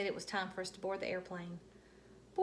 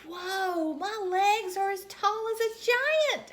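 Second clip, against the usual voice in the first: high, loud wow; amazed kid; 12 semitones higher, 9 dB louder, slower than usual. Acted. Junie B amazed her legs reach the seat, high and thrilled. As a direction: marvel at your own long legs.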